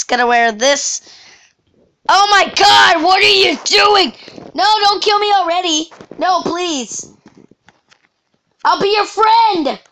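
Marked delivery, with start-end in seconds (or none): 2.1-9.8 high, frantic begging; panicked pleading. Real, not acted. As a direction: plead for your life in panic. Attacked by another player.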